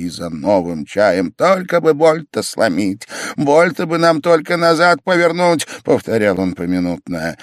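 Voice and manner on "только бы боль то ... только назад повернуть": high, insistent, worked-up hope; will the pain away out loud; eager urging. A narrator's reading. Pavel willing the patient's pain to break, eager.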